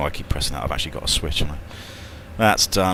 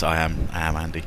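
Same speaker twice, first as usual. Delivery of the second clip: normal talking voice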